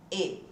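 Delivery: E as a closed, low-pitched ê. Shown as a demonstration. Teacher has students repeat the sound, low closed ê. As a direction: say "E" closed, as with a circumflex. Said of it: "aquele E com acento circunflexo".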